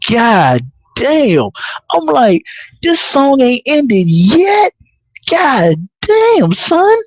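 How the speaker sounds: high-pitched exclaiming, drawn out; worked-up disbelief. Retold or imitated. Viewer reacting to the song that won't end, high exasperated disbelief.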